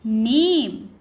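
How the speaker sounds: नेम said slowly and clearly as "naym"; clear English name. Shown as a demonstration. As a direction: model नेम for the class. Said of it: "जैसे". ("नेम" साफ़ उच्चारण में)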